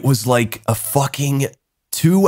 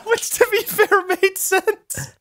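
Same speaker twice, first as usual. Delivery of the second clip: much higher, words squeezed through laughter; laughing; slower than usual. Real, not acted. Admitting his friend had a point, laughing. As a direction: say it while still laughing.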